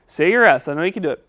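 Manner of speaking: ordinary delivery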